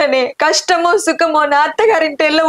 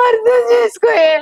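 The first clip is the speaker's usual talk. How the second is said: crying plea; 7 semitones higher than usual. Acted. Daughter begging her mother to understand, sobbing cry. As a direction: beg her through tears, voice breaking high.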